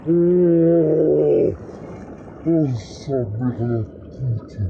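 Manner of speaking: very slow, loud groaning curse; in ice cold water. Real, not acted. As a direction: drag it out, numb with cold. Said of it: "Elle est gelée".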